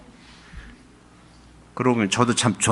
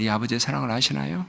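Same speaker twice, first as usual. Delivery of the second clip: normal talking voice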